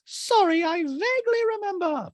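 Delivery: very high mimicking voice; Dorit impression. Dorit answering Garcelle, squeaky high put-on voice. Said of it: "And Dorit goes".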